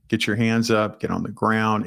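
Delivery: ordinary delivery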